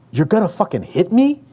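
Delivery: high, shocked, spat out. A narrator's reading. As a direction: spit it out in disbelief. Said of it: "I managed to spit out".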